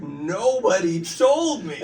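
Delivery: loud, high, drawn-out mock outrage; incredulous protest. Actor to director about dropped accent, laughing disbelief. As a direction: exclaim it slowly through amusement. Retells his shock to director.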